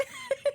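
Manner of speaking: very high, short laughing squeal; piercing quick giggle; squeal of laughter. Real, not acted. Laughs at chat.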